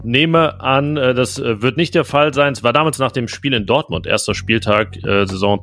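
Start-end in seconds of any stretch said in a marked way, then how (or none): none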